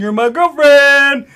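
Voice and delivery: loud, high, drawn-out put-on bawl; mimicked pestering customer. Imitating the customer ignoring the girl's rebuff, bawled insistence. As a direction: bawl it slowly as the oblivious pest. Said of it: "walk up to all the girls ... and be like".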